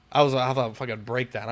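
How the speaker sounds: ordinary talk, nothing marked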